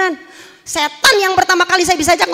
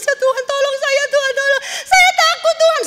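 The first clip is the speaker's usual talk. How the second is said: terrified crying plea; 12 semitones higher than usual. Retold or imitated. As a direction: beg God for rescue in panic. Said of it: "saya menangis".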